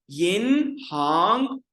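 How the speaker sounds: slow, loud यिन हांग with two rising tones; teacher pronouncing Chinese for class; Mandarin model word. Shown as a demonstration. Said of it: "Then आगे जी".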